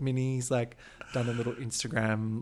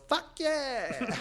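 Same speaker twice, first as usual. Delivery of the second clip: imitated catchphrase yell; 12 semitones higher than usual. Podcaster imitating the winner's catch cry, high mock yell. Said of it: "She yells".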